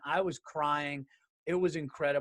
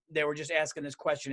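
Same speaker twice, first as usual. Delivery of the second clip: ordinary talk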